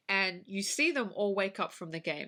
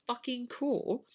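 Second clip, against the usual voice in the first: normal talking voice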